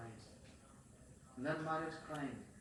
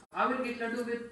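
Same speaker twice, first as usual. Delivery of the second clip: put-on defiant child; 9 semitones higher, 11 dB louder, faster than usual. Defiant child answers father.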